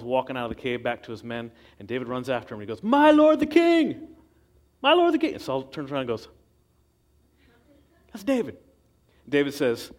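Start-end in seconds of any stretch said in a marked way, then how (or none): 2.8-5.3 high, drawn-out shout to someone far off; calling out loud. Acted. David shouting after Saul leaving the cave, loud and slow. 8.1-8.6 surprised recognition. Acted. Saul recognises David.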